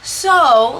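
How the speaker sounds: normal talking voice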